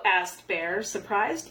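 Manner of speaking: usual speaking voice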